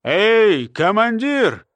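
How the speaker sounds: mild hail to a commander; lightly acted shout for attention; calling from outside. A narrator's reading. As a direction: call out, drawing out the title. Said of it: "послышался голос снаружи".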